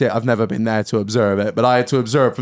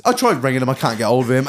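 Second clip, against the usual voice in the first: usual speaking voice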